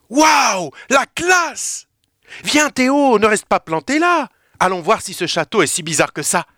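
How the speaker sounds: thrilled, dragging him along; amazed and excited. Acted. Emma émerveillée dans le hall. (émerveillée et enthousiaste, très aiguë)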